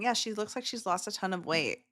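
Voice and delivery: usual speaking voice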